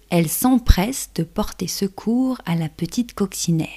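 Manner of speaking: ordinary delivery, nothing marked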